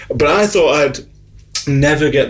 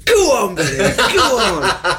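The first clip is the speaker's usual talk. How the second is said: cheering; 5 semitones higher, slower than usual. Retold or imitated. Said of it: "Sarah's like going".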